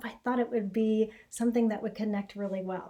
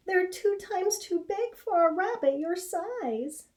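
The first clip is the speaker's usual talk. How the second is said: mother rabbit voice; 9 semitones higher than usual. Acted. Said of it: "his mother exclaimed in surprise".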